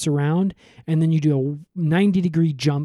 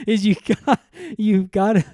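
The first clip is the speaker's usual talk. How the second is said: higher, put-on bossy cop voice; lightly voiced bossy cop; mock cop; 5 semitones higher than usual. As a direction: stumble like a flustered cop. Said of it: "he's like".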